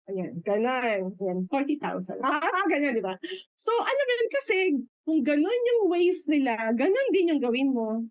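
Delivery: normal talking voice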